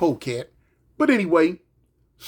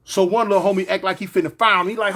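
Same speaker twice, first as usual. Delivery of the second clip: ordinary talk, nothing marked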